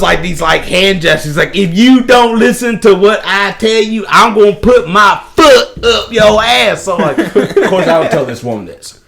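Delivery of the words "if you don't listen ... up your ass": loud, high mock drill-sergeant threat; Major Payne. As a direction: punch out each word, slowing into the threat. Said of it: "Major Payne".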